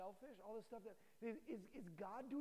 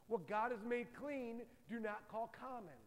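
slow solemn God voice; 4 dB louder, slower than usual. Acted. Voice of God speaks slowly.

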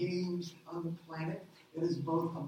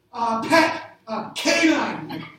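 "pet" and "canine" said much louder, slowly drawn out; louder clue; 3 semitones higher, 12 dB louder, much slower than usual. Retold or imitated. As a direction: push the clue louder and slower. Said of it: "Stop yelling the same words".

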